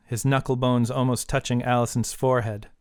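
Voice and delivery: usual speaking voice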